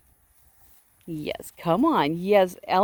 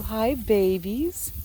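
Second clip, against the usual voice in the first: slow, high coo to the sheep; cooing greeting; 6 semitones higher, much slower than usual. Real, not acted. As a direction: stretch it out tenderly like to babies.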